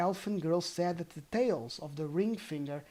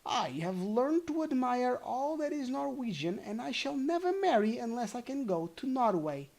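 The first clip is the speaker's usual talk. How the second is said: put-on a girl's voice pitched high above the narration; proud young daughter; daughter's voice, high; 7 semitones higher than usual. Daughter boasts to the goblin in a high voice. Daughter's reply, first reading.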